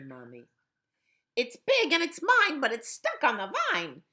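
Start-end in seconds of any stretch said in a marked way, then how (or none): 1.3-4.0 high, loud cackling-witch voice, rushing at the end. Acted. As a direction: play the bossy witch claiming the pumpkin. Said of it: "said the witch".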